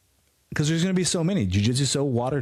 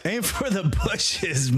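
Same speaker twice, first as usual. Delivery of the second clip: higher, slow drawn-out catchphrase; catchphrase call; 8 semitones higher, slower than usual. Acted. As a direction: sing out the show's tagline. Catchphrase sign-off.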